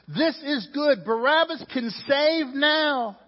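high, loud rabble-rousing; urgent agitator, rising in pitch; priests stirring up crowd. Acted. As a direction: whip up the crowd, building to a shout. You see the priests, they went in and said.

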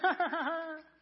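high, loud teasing laugh; mock laugh. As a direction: laugh loudly at the tiny man. Laughing at the snow globe man.

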